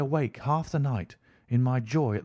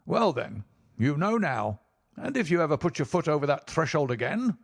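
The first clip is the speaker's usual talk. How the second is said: menacing; 3 semitones higher than usual. Acted. Rucastle turns threatening.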